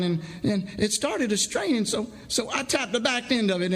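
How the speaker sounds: high, fast, stammering and shaken; nervous saw filer recounting the accident. Acted. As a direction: blurt it out, tripping over every word. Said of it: "a muscle in his right cheek shuddered".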